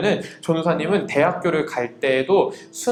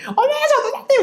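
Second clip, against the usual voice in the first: very high, gushing middle-aged woman; mock an over-friendly auntie's loud squeal of greeting; loud chatty evangelist; 4 dB louder than usual. Middle-aged evangelist spotting an acquaintance, loud gushing impression. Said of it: "목소리 크고 말 많은 전도사님이".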